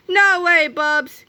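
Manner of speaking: high, loud refusal; defiant cry. A narrator's reading. Captain Underpants turns Melvin down flat, loud defiant cry. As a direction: cry it out punchily. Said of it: "cried Captain Underpants".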